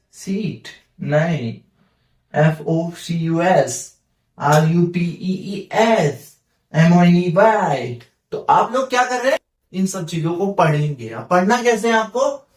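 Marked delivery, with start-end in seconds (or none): 2.4-8.0 each letter of एफ ओ सी यू एस read out slowly and apart; letters spelled slowly. Shown as a demonstration. Teacher models spelling words aloud, slow and letter by letter. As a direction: spell the word letter by letter, unhurried. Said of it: "ऐसे पढ़ना है".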